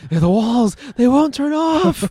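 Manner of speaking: high, eerie madman voice; creepy mad voice. Acted. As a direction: play a crazed inmate, rising high. Quoting Clockwork Orange madness.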